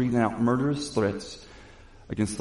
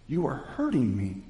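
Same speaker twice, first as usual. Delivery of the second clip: normal talking voice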